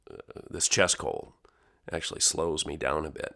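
usual speaking voice

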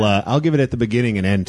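ordinary talk

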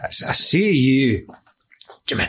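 very drawn-out mock Connery accent; horrific film accent. Asking Arthur what that was, drawn-out mock accent. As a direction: stretch out the bad accent, pausing before the name. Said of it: "That one was horrific".

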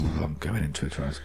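low, soft trance drone; going into a trance. Pretend. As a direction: intone it low and hushed, as if drifting under. Pretends to enter a trance.

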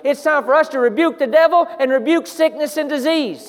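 loud, high, building preacher's cry; fiery revival preaching; preaching at full force. Acted. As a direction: drive the point home to the congregation.

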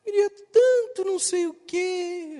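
put-on high sugary little-kid call; sweet child voice. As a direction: coo like a kid buttering up grandpa. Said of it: "o [name] muda até a voz".

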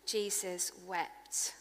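soft, slow repeat. Acted. Repeated softly after whisper.

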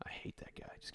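whispered aside. Acted. A cohost whispers a joke insult about a celebrity. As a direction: confide it under your breath.

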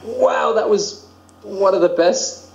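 awed wow. Retold or imitated. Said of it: "we were just like".

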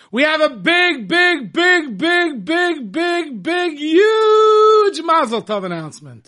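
high, loud, hyped-up big reveal, punching every "big"; very excited announcement. Real, not acted. Radio host trumpeting a family mazel tov, very excited. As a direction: build up the big news, stretching "huge". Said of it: "you sound so excited".

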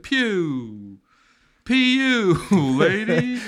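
high loud disgusted joke; mock disgust. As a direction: sniff out the stink. Mock reply.

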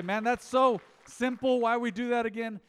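mock sceptical listener; imitating a doubter. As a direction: play the sceptic in the pews. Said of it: "You say".

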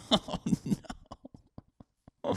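normal talking voice

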